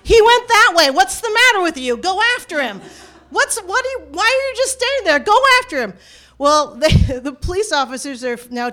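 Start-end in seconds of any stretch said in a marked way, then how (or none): 0.0-2.8 high, loud, exasperated yell at police; raised pitch, urgent and demanding. Retold or imitated. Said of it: "he is yelling at the police". 3.3-5.9 high-pitched, exasperated demand, building louder; sputter, then yell the order. Retold or imitated. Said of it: "he is yelling at the police".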